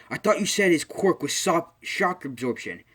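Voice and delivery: high, loud hero's protest, easing off at the end; acted indignant challenge. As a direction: call out the villain, play-acting. All Might challenges the villain.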